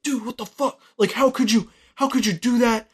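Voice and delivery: a lightly voiced dealer losing his temper; mock angry dealer. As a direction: retell the dealer blowing up at him. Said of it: "the dealer just starts going off on him".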